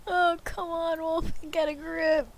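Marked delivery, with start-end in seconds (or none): none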